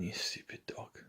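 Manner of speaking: hushed, barely voiced whisper; soft exasperated whisper to the dog; muttered thought. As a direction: whisper it under your breath, as if only thinking it.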